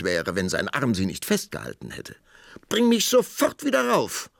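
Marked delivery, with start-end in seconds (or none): 2.7-4.3 very high, loud command; shrill royal order from above; demanding princess. A narrator's reading.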